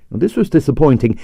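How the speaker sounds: usual speaking voice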